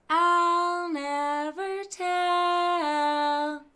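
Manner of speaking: high, very slow, drawn-out singsong; secretive singsong. A narrator's reading. Miss Nelson to herself at bedtime, slow secretive singsong. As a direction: savour the secret with a smile. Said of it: "she said to herself with a smile".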